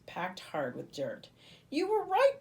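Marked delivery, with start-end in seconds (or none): 1.7-2.4 high, loud astonished rise; can't believe it; amazed. A narrator's reading. Livy finding the jar, amazed and high.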